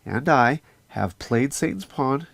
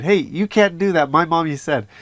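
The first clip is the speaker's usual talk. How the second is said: higher, louder child imitation; little kid scolding; 6 semitones higher, 7 dB louder than usual. Little kid tells parents off on playground, mock bossy. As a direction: imitate a kid enforcing mommy's rule. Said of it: "this little kid came up".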